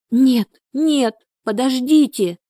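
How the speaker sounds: high desperate cry in sleep; nightmare moaning. Acted. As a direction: cry out from a bad dream. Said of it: "закричала во сне Люба". (отчаянный крик во сне)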